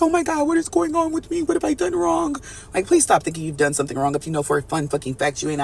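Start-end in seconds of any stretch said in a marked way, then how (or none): 0.0-2.4 high, breathless mock panic; panicky inner voice. Mimicking a viewer's inner voice, mock panic. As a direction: play the worried viewer freaking out. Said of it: "all these emotions could make some of you guys feel like".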